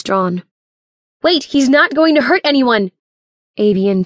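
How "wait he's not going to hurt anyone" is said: high, raised, pleading shout; urgent yell. Acted. Narrator shields Doctor Evans from the guns, urgent yell. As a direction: yell urgently to hold off attackers. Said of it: "I yelled as the group raced".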